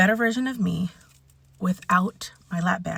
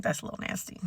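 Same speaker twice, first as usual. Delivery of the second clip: whispered inner voice; under your breath, unvoiced; whispered aside; 13 dB quieter, faster than usual. Her own inner voice judging unwashed hair, whispered. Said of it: "mentally, you're kinda like".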